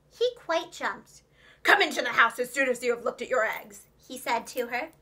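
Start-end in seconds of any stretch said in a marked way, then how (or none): 1.6-3.9 louder, hard fox command; harsh. A narrator's reading. Fox orders Jemima inside, harsh and loud. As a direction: drop the charm, bark it. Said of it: "He spoke very harshly".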